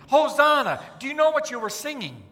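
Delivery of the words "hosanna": called out louder, like the crowd; crowd shout. Retold or imitated. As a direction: cry it out to welcome the king. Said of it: "like you guys were singing".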